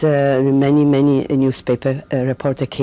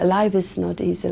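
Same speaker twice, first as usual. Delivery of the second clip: ordinary talk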